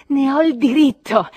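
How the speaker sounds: very loud, high outburst; defiant shout. A narrator's reading. Narrator climaxing his claim to sing, defiant shout. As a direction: shout your right at the top of your voice.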